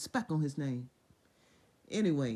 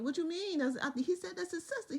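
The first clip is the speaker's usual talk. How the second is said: much higher, baffled pleading; confused protest; 11 semitones higher than usual. Retold or imitated. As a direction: protest like a wrongly accused man. Abimelech confused when God confronts.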